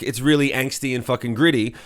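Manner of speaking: usual speaking voice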